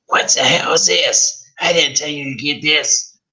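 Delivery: high, scratchy scolding crone; sour old mother. Acted. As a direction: snap it like a sour hag. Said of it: "The tone of her voice turned sour".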